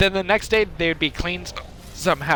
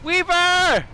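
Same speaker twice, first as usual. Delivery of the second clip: calling out; 14 semitones higher, much slower than usual. Real, not acted. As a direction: shout the name to get attention. Calling out to a friend.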